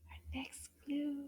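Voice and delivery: hushed suspense whisper. Acted. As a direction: whisper it like a secret. Teacher whispering to build suspense.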